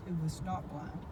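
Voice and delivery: ordinary talk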